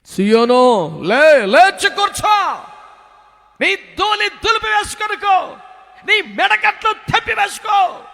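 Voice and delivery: loud, high, thundering call; God shouting to Zion to rise; God's commanding voice. Acted. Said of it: "దేవుని స్వరం".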